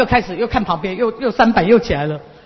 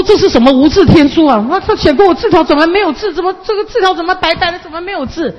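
high, loud, startled exclamations; puzzled disbelief at a blank note; baffled outburst; 8 semitones higher, 5 dB louder than usual. Retold or imitated. (老师吃惊地高声大叫)

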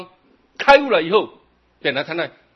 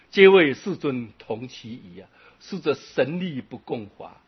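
sutra recitation; 4 dB louder than usual. Acted. Quoting sutra verse in sermon.

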